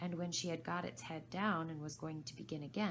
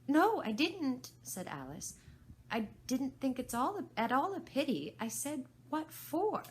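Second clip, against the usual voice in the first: Alice, high; 9 semitones higher, 7 dB louder than usual. Acted. Alice corrects Rabbit.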